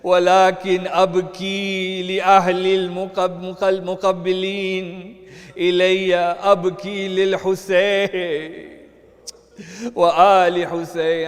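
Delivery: loud, tearful preaching cry; grieving martyr's lament, half-sung; impassioned weeping plea. Acted.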